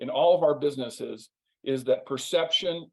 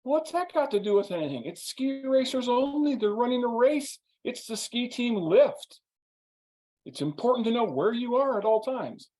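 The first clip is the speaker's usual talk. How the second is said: much higher, pedantic insistence; high mock know-it-all voice; stubborn lecture; 9 semitones higher than usual. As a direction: lecture your friend on the rules, offended. He's like.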